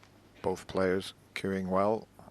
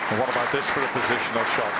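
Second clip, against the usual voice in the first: excited commentary; 4 semitones higher, 6 dB louder, much faster than usual. Real, not acted. Commentator reacts to great shot.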